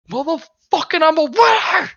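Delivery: shrieking with glee at the win; triumphant yell. Acted. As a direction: crow like a champion. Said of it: "and you're like".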